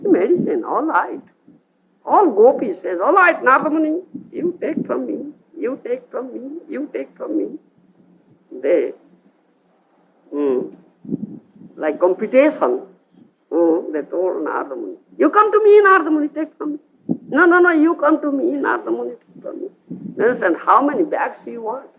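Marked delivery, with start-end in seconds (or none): 3.0-7.6 high, fast and eager, pressing the offer; the gopis all eagerly offering their dust to Narada, high and urgent. Acted. The gopis crowding to offer their dust to Narada, high and eager. 15.2-19.7 much higher and pleading, each one jostling to be chosen; competing gopis. Acted. Gopis vying with each other for Narada's attention, high and insistent.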